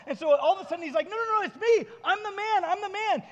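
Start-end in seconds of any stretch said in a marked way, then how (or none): 1.1-3.2 high, loud, eager insistence; acted excitement. Healed man convincing people who knew him, excited. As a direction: play him bursting to be believed. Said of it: "And he's all excited".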